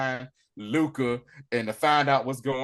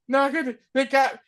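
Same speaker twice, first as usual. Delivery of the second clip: ordinary talk, nothing marked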